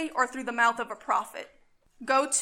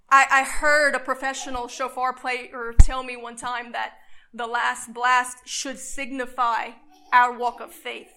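ordinary talk

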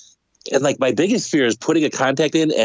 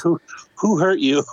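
higher, slow, teasing sympathy; mock concern; 6 semitones higher than usual. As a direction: ask it slowly with playful, pretend concern. Jokes as onlookers seeing puffy eyes.

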